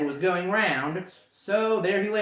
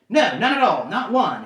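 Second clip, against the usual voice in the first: louder, hurried correction; hasty denial; 4 semitones higher, 7 dB louder than usual. Acted. Bilbo hastily denying to trolls, louder rush. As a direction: take it back in a rush. Hobbit hastily takes it back.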